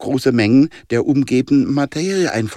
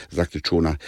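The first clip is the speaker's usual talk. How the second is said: ordinary delivery, nothing marked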